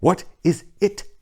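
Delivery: panicked plea down the line; terrified. A narrator's reading. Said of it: "Terrified, I continued to repeat".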